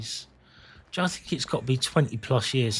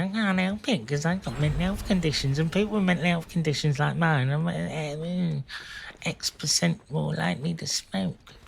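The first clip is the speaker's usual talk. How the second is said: higher-pitched mock excuse-making; put-on helpless voice, pitched up; self-excusing plea; 6 semitones higher than usual. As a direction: plead your case as a feeble excuse. Mimics a self-excusing smoker.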